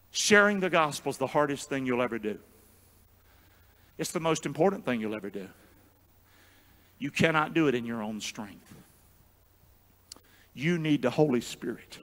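low, soft preaching; solemn hush. Real, not acted. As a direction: bring it right down, earnest and quiet. Solemn hush after shouted preaching.

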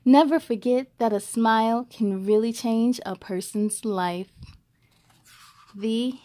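clear, even read-aloud voice; story narration. A narrator's reading. Host reading a picture book to young viewers, storytelling voice.

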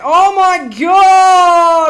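slow, high, loud cry; shocked shout. Real, not acted. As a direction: wail it at the disaster. Dramatic slow-mo death in game.

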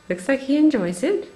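normal talking voice

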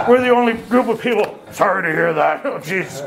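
breaking up laughing mid-sentence; real laughter. Real, not acted. Speaker laughing at his own joke to the group, laughing.

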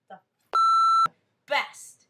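high drawn-out shout; shouted hammed-up. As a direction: belt it out, stretched and high. Repeated thanks, hammed up.

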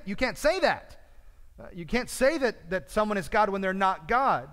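loud, high-pitched acted protest; hammed-up shocked correction, starting at its loudest; alarmed objection. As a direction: cut in urgently to stop a blasphemy. Acts out Jesus hypothetically correcting Thomas.

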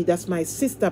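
usual speaking voice

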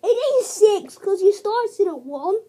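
insistent. Real, not acted. Timing argument.

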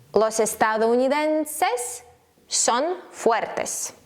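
slow Spanish, carefully spaced words; slow teaching delivery. Shown as a demonstration. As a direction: model it slowly for learners. Slow model sentence for learners.

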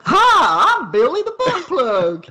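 squeaky goofy mascot voice; silly character voice. Pretend.

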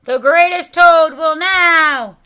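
very loud, high stage announcer; strange voice announcing. A narrator's reading. Strange voice begins another announcement, booming. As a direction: boom it out like a ringmaster. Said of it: "Then the voice said".

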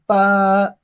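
"Ba" held long on a level pitch; flat tone. Shown as a demonstration. Teacher models Chinese eight for learners, flat-tone "Ba". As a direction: sustain a firm, unbending "Ba". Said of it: "the flat tone".

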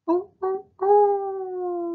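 very high loud sung howl. Acted. Friend howling at the moon, high howl.

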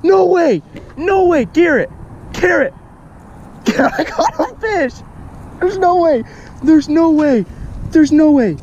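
very high, loud squeals of disbelief; real excitement. Real, not acted. Calling to Garrett about the catch, wildly excited. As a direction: shriek it, thrilled and amazed.

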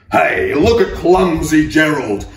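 loud jeering call across the dance floor; mocking roar. Lions jeering at clumsy Gerald, loud mocking call. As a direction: jeer it loudly like a bully. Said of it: "they soon began to roar".

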